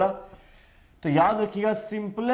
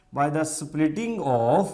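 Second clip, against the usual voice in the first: slow dictation; much slower than usual. Shown as a demonstration. Dictating notes to students.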